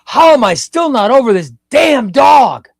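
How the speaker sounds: loud, high, exasperated shout; a frustrated outburst at yourself; angry yell. Retold or imitated. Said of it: "I yell. I'm like".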